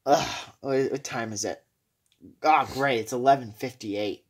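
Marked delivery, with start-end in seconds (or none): none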